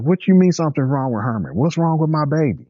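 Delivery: alarmed mother. Retold or imitated. Mother alarmed about her son.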